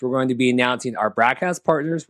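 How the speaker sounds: normal talking voice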